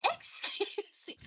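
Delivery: breathy, slow, high and hushed; offended whisper. Acted. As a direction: hiss it out, scandalized. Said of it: "She's smacking you across the face like".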